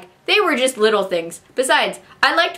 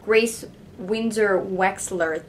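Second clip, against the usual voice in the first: slow careful name; 4 dB quieter, much slower than usual. Shown as a demonstration. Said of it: "That is hard to say".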